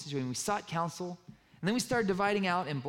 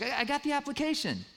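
proud; 10 semitones higher than usual. Retold or imitated. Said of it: "She was so proud of herself".

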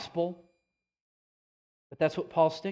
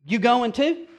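a hint of Jesus, higher and pointed; mild Jesus voice; 6 semitones higher, 5 dB louder, slower than usual. Jesus asking the disciples, lightly voiced. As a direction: ask it gently but pointedly. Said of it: "and he tells them".

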